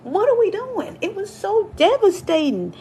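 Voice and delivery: high, loud exasperated cry; exasperated outcry. Real, not acted. Complaining about software from ninety-three, loud high exasperation. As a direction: throw your hands up in loud disbelief.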